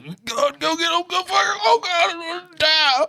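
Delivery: very high, strangled mock sobbing; imitated winded gasping. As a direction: squeeze it out as if unable to breathe. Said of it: "somebody was always getting winded".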